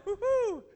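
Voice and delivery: high falsetto woo-hoo; mock cheering whoop. Bystander cheering teens on tubes, mock gleeful whoop.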